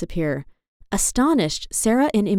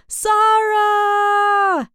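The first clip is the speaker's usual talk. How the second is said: rising to high, loud calls; shouting for her friends as they slide, building to high loud calls; excited exclaiming; 9 semitones higher, 4 dB louder, slower than usual. A narrator's reading. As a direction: call out wildly, higher and louder. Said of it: "exclaimed Jade".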